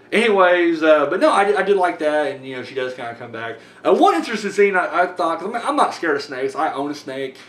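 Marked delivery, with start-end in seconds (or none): none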